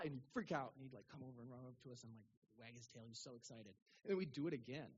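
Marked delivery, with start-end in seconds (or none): none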